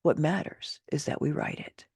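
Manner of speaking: plain reading voice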